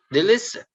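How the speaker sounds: "delicious" with LI pitched high; stress on middle syllable. Shown as a demonstration. Teacher reading out the middle column, middle stress on "delicious". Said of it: "stress on the middle".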